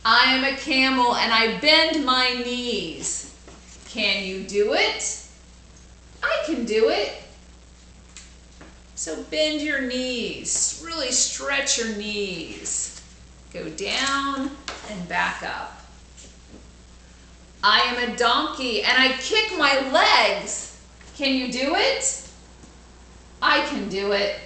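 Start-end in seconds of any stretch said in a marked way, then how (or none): 0.1-7.2 put-on bright, louder storybook reading for kids; lively read-aloud. 17.6-24.4 put-on bright, louder storybook reading for kids; lively read-aloud.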